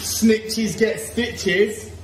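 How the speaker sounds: drawn-out singsong taunt; slow mock taunt. Dad teasing the kids about snitching, slow mock threat. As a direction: stretch every word, teasing.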